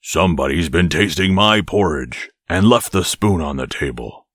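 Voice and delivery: great big bear voice; growling father bear. A narrator's reading. Father bear finding his porridge tasted, big growly voice. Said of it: "growled the father bear in his great big voice".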